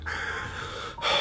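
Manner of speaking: imitating dad's heavy, labored breaths; a long unvoiced wheezy exhale, retold; heavy breathing. Son imitating his sick dad's breathing on the steps, labored breaths. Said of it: "like, breathing really heavy".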